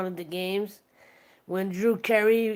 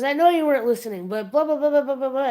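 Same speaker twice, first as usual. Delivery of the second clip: higher, louder, rushed; exasperated improviser; 5 semitones higher, 5 dB louder, much faster than usual. Retold or imitated. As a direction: rattle it off fast, fed up. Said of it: "He goes".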